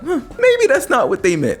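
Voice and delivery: very high, airy mock innocence; the streamer mocking a charitable reader, in a very high mock-innocent voice; mock naive. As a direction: play the clueless fan, way up high. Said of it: "be like".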